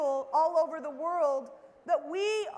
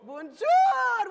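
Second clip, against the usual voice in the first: big loud cheerful Bonjour, pitched high; loud and proud; 8 semitones higher, 10 dB louder than usual. Shown as a demonstration. Speaker greeting the audience in French, loud and proud. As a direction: call it out proudly to the room. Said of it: "okay, loud and proud".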